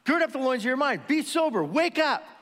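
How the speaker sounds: high-pitched urgent wake-up call; Peter. Acted. Apostle rousing the distracted believers, urgent exhortation. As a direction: call out sharply to rouse them. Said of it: "And Peter's gonna say".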